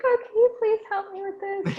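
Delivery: high sweet asking voice; mimicking girls who sweet-talk IT, high and sweet; girly request. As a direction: ask in a high girly plea. Imitating girls asking for help.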